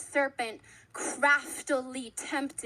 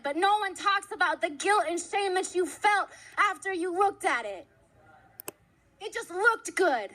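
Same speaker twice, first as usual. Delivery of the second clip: impassioned crying preacher; 4 semitones higher than usual. Real, not acted.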